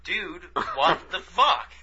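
slow, laughing "what the fuck" at God; asking God, slow and laughing in disbelief; drawn-out exasperation. Retold or imitated. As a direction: stretch it out in disbelief. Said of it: "It's like".

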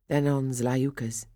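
usual speaking voice

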